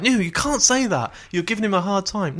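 lightly put-on, high-pitched objection; mock protest. Voices people objecting to his criticism.

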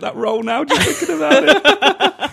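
helpless, very high squeal of laughter; breaking up completely, slowing to a squeak; laughing. Real, not acted. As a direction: squeeze the words out through real laughter. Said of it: "I'm just laughing".

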